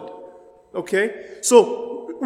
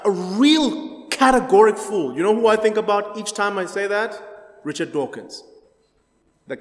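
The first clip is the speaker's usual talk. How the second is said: ordinary talk, nothing marked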